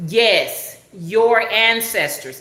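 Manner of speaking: ANCESTORS said slowly in careful, proper English; trying to talk proper. Retold or imitated. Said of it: "when I tried to talk proper".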